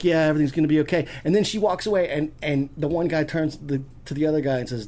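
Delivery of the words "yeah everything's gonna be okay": fast, louder adult voice, no falsetto; reassuring ex-con. Retold or imitated. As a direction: imitate a man hurriedly reassuring a child. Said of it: "And he's like".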